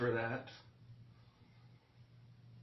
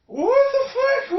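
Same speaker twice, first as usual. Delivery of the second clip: high, loud and slow outraged yell; real furious high shout; 15 dB louder, slower than usual. Real, not acted. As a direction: scream it at the screen in disbelief. Outraged at fight video.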